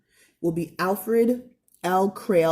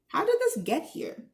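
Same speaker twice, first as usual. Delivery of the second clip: much higher, curious wonder; wondering question; 10 semitones higher than usual. Acted. [name] teaching the kids, higher with wonder. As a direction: ask it with childlike wonder.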